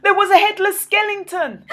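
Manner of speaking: high, loud shocked reveal ending in a gasp; frightened gasp. A narrator's reading. Narrator reveals the skeleton with a loud gasp. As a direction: gasp at the sight.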